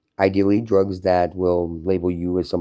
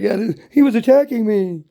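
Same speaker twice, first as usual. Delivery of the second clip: much higher, whiny put-on voice of the neighbor; mocking the neighbor; 5 dB louder than usual. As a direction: whine it like a fake victim. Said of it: "He's like".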